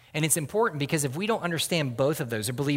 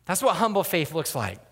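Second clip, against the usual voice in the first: usual speaking voice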